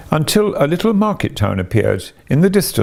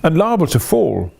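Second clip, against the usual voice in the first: remonstrating; 5 semitones higher than usual. A narrator's reading. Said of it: "Scrooge remonstrated".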